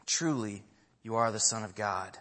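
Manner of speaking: low, softened declaration; hushed reverence. Retold or imitated. Preacher closing the message on the disciples' words, low and reverent. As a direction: say it low and softly, as a reverent close. Repeats the response as quiet close.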